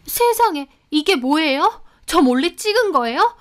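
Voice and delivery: high-pitched indignant outburst; frantic fuss. A narrator's reading. Housekeeper accuses employer of secret filming, frantic. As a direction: make a huge scandalized fuss. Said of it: "호들갑을 떨며 난리를 치는 겁니다".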